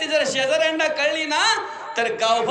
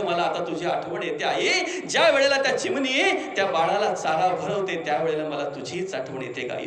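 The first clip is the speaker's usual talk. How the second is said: tearful longing; 9 semitones lower, 4 dB quieter than usual. Real, not acted. Missing his dead mother.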